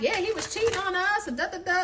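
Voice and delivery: high-pitched mock tearful whine; crying, hurt mistress. Mistress telling the main female he cheated, mock crying. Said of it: "Like, she's crying. She's hurt".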